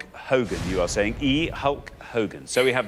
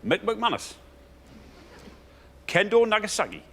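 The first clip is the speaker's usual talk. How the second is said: Geordie accent; 8 semitones higher than usual. Shown as a demonstration. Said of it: "So say that in a Geordie accent".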